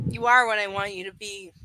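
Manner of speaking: low, measured emphatic insistence; narrated man's emphatic line. A narrator's reading. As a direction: insist on "You are". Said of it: "his voice emphatic".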